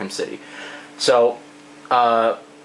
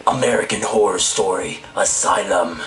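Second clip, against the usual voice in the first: lower, rough character read; gruff villain voice; 4 semitones lower than usual. Shown as a demonstration. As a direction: growl the title like a horror villain. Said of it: "That's a hard voice to do".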